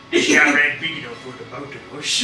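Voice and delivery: put-on Western Cockney accent. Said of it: "A Western Cockney accent".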